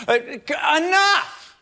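high laughing cut-off; sudden enough. Acted. Cutting off the repetition.

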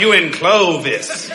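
put-on slow local accent, drawn-out Clovis. Preacher repeating how the local man talked, slow local accent. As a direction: imitate the local accent with a leisurely drawl. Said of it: "That's how he talked".

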